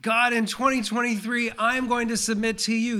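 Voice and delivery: a lightly voiced eager believer vowing to God; mountaintop prayer. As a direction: pray it like you're on top of the world. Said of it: "on that mountaintop saying".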